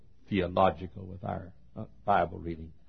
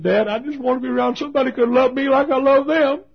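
pleading son; 9 dB louder than usual. Retold or imitated. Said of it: "He said".

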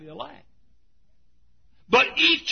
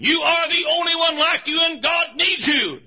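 preaching shout; 7 semitones higher than usual. Retold or imitated. Pastor recalling what he shouts from the pulpit, rising preaching shout. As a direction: hammer the line out like a revival call. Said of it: "I'd say the same thing".

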